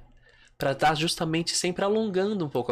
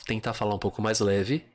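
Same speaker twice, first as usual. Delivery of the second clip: normal talking voice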